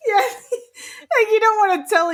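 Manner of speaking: high giggly words through laughter; laughing too hard to talk straight. Real, not acted. Laughing at the story.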